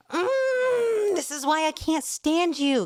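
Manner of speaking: high, cringing whimper of disgust; squirming, repulsed whine; shuddering disgust. A narrator's reading. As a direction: recoil as you say it, slowed. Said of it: "Kiriyama shuddered and backed away".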